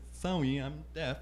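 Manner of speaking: mild deaf voice impression; deaf voice imitation. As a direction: lightly imitate a deaf woman's speech, quiet. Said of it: "she replies in sign language and deaf voice".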